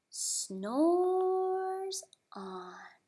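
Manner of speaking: hushed storytelling whisper. A narrator's reading. Reading to a child, hushed whisper. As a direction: whisper it so the bear stays asleep.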